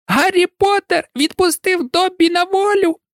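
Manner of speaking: very high piercing elf squeal; shrieking house-elf. A narrator's reading. Dobby shrieking with joy at Harry. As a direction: shriek it out in delight. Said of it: "пронизливо заверещав ельф".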